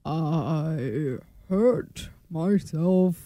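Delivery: very slow, low, soft mock [name]; [name] impression. Shown as a demonstration. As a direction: drag each word out like old [name]. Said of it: "it sounds like this".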